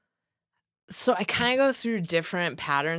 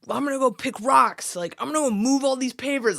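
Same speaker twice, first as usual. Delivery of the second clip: higher, louder, revved-up inner voice; eager self-quote; 8 semitones higher, 3 dB louder than usual. Retold or imitated. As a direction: pump yourself up for hard labour.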